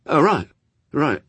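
quick, high, flustered agreement; hurried. A narrator's reading. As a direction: agree at once, in short words. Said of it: "said Crowley hurriedly".

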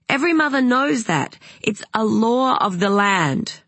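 high, emphatic proclamation, slowing at the end; indignant Madeline. Acted. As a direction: declare it like an outraged mother.

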